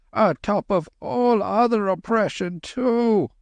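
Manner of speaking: high frightened hillman; clamouring coolie. Acted. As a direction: wail of dread.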